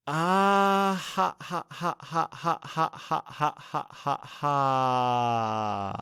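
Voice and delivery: long high "ah", staccato ha-has falling away; voiced laugh-breath, ending on a long exhale; demonstrated laughter pattern. Shown as a demonstration. Teacher shows the breathing students the laughter pattern, demonstrated laughter. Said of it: "the actual laughter pattern goes like this".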